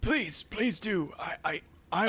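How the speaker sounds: high-pitched, halting plea; pleading old man. Acted. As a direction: beg gratefully as the old attendant, stammering. Old attendant pleads back.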